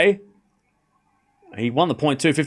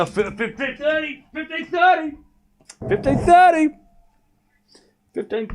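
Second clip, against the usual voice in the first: playful score calling; 13 semitones higher than usual. Real, not acted.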